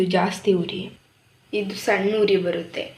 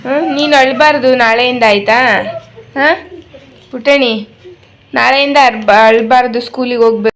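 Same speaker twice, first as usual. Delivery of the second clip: loud, higher, firm encouragement; loud coaxing; 4 semitones higher, 12 dB louder than usual. Real, not acted. Mother loudly telling her son not to cry tomorrow.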